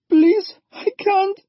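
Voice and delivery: put-on high, crying girl's voice; girl sobbing to her mother; sobbing plea. A narrator's reading. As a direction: beg through tears.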